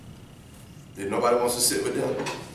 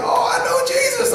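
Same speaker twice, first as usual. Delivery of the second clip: eager claim; 5 dB louder than usual. Retold or imitated. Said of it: "and we're like".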